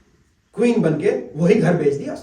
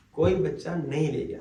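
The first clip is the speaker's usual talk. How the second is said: کوئی بچہ نہیں لے گیا said again lower and softer, after a long pause; quiet sad repeat; 4 semitones lower, 5 dB quieter than usual. Shown as a demonstration.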